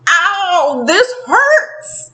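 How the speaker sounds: high, hammed-up cry of pain; a light playful ouch; mock pain. Angel becoming mortal, mock pained cry.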